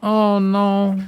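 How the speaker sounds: ordinary talk, nothing marked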